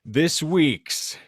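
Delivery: ordinary talk, nothing marked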